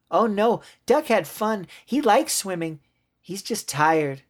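put-on high child's protest; high-pitched childish denial; insistent little girl. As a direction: defend a friend in a small kid's voice. Girl insists Duck is fine.

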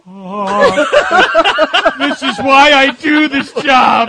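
hammed-up joy at finding the jackpot; mock thrilled researcher. Imitating the thrilled opposition researcher.

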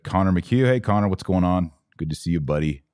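ordinary talk, nothing marked

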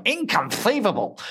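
high-pitched movie-quote exclamation; Wallace Shawn impression. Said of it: "I once saw Wallace Shawn".

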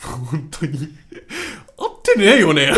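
can barely speak for laughing; real helpless laughter; laughing to tears. Real, not acted. Laughing at the wrong lyric.

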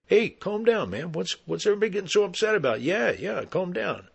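high-pitched, hurried appeasing; placating backpedal. Retold or imitated. Hairy man giving in to the cop voice, placating.

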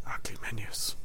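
soft, breathy whisper of the phrase; whispered echo. Acted. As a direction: drop to a secret whisper. Whispered echo of ugly menus.

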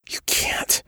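a frightened whisper. A narrator's reading. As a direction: barely breathe the warning. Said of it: "he whispered".

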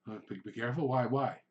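acted bewildered customer; puzzled questioning. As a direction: repeat the warning back as a worried question. Couple question the warning.

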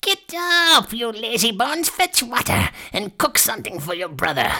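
high crone voice, starting on a cry; witch ordering. A narrator's reading. Witch shakes Gretel awake, ordering in crone voice. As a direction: rouse her as a high-voiced, bossy old witch. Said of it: "and cried".

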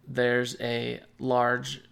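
usual speaking voice

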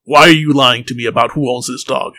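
loud, commanding soldier's challenge; stern interrogator. Acted. Soldier questioning a little one about the dog, stern and loud. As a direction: demand answers from a cowering underling.

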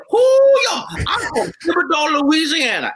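loud, high Cajun woman hollering her intro; Cajun accent. Retold or imitated. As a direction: play a brassy Cajun lady from Thibodaux. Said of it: "she'd be all Cajuned up".

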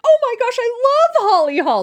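high happy mock gush; delighted gush. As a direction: gush about an old tour guest. Hank's delighted reaction retold.

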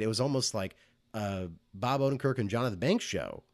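ordinary talk

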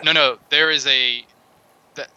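ordinary delivery

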